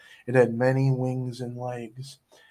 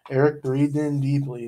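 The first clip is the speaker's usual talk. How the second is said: ordinary delivery, nothing marked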